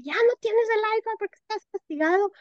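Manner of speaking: high, scolding parent voice quoting a punishment. Retold or imitated. Mother acting out a scolding parent to her child, high and stern. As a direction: play the stern parent announcing the iPad is gone. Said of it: "cómo la decimos vale muchísimo en decirle".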